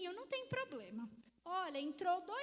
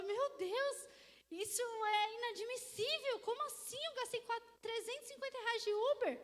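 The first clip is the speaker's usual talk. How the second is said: mock distress; 6 semitones higher than usual. Crying client voiced in retelling.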